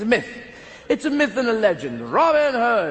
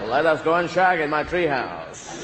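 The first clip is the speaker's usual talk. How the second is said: slow, hammed-up American seducer; 3 semitones lower, slower than usual. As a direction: drawl it like a cheesy Hollywood lover.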